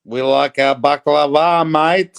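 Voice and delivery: put-on loud Greek Aussie accent, leaning on mate. Mimicking a baklava-loving YouTuber for a friend, loud Greek Aussie accent. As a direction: ham up a Greek Australian baklava seller. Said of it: "the Greek Aussie accent is brilliant".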